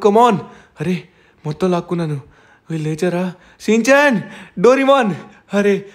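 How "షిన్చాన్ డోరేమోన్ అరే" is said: calling out. Acted. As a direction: call out their names across the field. Calling out to fallen friends.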